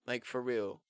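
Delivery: ordinary talk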